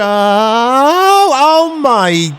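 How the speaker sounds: high, loud cry of dismay; a loud startled oh my, caught just before cursing; near-swearing exclamation. Real, not acted. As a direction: bite back a swear word. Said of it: "I nearly swore".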